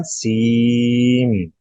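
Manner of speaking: सीम, with the n merged into m, held very long; nasal merge. Shown as a demonstration. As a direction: stretch सी then close on m. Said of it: "सीन नहीं होगा". (सीम, — नून का मीम में इदग़ाम, लंबा)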